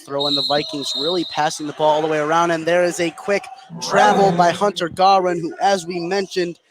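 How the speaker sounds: ordinary talk, nothing marked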